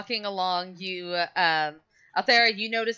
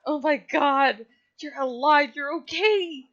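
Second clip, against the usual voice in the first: relieved overjoyed; 7 semitones higher than usual. Acted. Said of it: "He's like".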